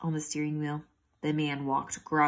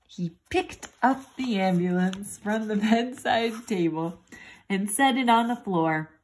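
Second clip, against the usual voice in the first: ordinary delivery, nothing marked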